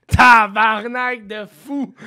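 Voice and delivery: loud, drawn-out swearing; acted amazed reaction. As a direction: play a stunned viewer's big discovery. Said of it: "ils vont faire".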